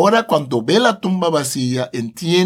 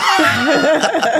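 real laugh; 10 semitones higher than usual. Real, not acted. Laughing at impulsive Peter.

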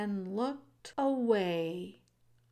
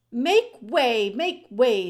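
loud crowd-clearing call; shouted; 3 semitones higher, 8 dB louder, slower than usual. A narrator's reading. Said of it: "shouted Furlow".

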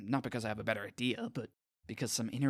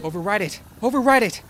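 shouting orders, higher and much louder; barked order; 10 semitones higher, 15 dB louder than usual. A narrator's reading. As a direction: scream the order in panic. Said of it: "Doctor Mintz barks".

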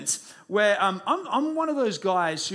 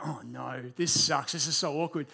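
dismay; 6 semitones lower, 3 dB quieter than usual. Retold or imitated. Retold dismay at being let down.

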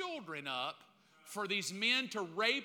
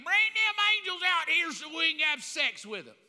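higher, louder crowd bellow; mob shout; 8 semitones higher, 6 dB louder than usual. Acted. Preacher voices the mob at Lot's house, loud and higher. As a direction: yell it like a mob at the door.

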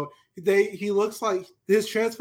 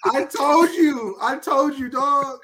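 high, drawn-out gloating shout; emphatic vindication; 4 semitones higher, slower than usual. Real, not acted. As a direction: crow it like you called it. Co-host crows that he was right.

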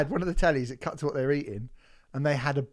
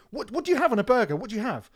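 1 flustered girlfriend imitated; 7 semitones higher, faster than usual. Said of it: "And she was going".

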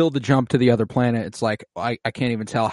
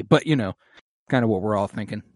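ordinary talk, nothing marked

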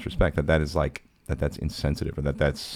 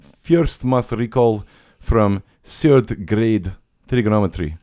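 put-on very slow, loud, higher Ukrainian accent; Miran Becker; 5 semitones higher, 7 dB louder, much slower than usual. Math teacher recalling lessons, emphatic slow Ukrainian accent. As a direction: draw each word out in a heavy Ukrainian accent. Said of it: "He had an awesome accent".

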